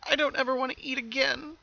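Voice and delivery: very high little filly voice, frightened; a scared child shrinking away; a young filly telling her father she won't eat; quivering with fear. A narrator's reading. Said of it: "her voice quivering with fear".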